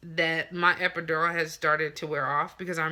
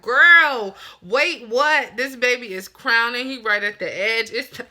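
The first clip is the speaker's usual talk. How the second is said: high, loud disbelieving outburst; exasperated yell; 7 semitones higher, 10 dB louder than usual. Retold or imitated. Mother protesting to nurses that the baby is crowning, loud disbelieving yell. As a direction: shout it like she can't believe it.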